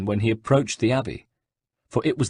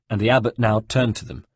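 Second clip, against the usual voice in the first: usual speaking voice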